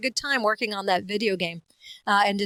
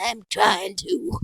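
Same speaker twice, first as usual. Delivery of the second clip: slow, strained comic reply; put-on character voice; 4 dB louder, much slower than usual. Character answering the yard owner, slow put-on voice. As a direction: drag out a comic protest.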